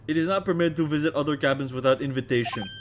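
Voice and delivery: put-on loud, heavy Russian accent; Russian crewman. Crewman introducing himself to visitors, loud Russian accent. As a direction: boom it out like a proud Russian sailor. Stroganoff voice.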